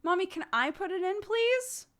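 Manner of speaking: put-on high, sweet asking child; polite child voice. Shown as a demonstration. Mother showing how her toddler should ask nicely, high sweet child voice. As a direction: model the nice way to ask, high and fast. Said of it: "You could say".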